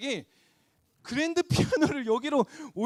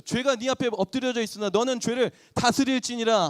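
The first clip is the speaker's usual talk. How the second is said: solemn scripture quote; 3 semitones higher, 7 dB louder than usual. Acted. Quoting God's word, preaching.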